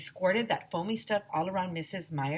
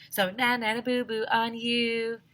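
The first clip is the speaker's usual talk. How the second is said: sing-song taunting, higher and louder; teasing; 5 semitones higher, 4 dB louder than usual. Acted. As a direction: tease the kids playfully. Teasing the listeners.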